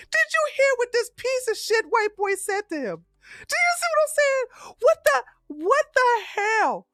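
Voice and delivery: can't believe what he heard; high-pitched outraged rant; outraged disbelief. Real, not acted.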